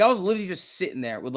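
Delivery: ordinary delivery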